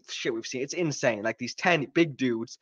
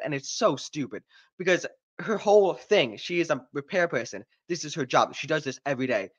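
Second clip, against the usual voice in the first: ordinary delivery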